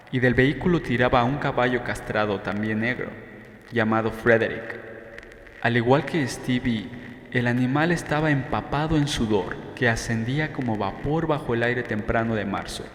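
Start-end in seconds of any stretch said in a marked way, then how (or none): none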